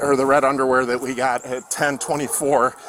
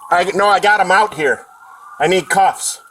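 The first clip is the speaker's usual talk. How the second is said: loud call; 4 semitones higher, 6 dB louder than usual. Real, not acted. Officer calling for cuffs.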